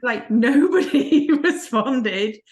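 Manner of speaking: amused, slow, drawn out with laughter; laughing. Real, not acted. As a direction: struggle to tell it through your laughter. Laughing while telling her funny story.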